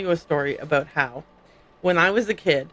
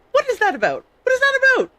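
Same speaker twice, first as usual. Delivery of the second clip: high-pitched comic bafflement; mock exasperation; faster than usual. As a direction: protest in a high, incredulous voice. Mock outburst at French h habit.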